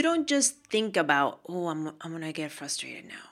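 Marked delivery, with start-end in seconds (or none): 1.5-3.3 low, soft musing aloud; mock deliberation. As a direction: quietly pretend to decide on a feeling. Voices a deliberate choice to feel.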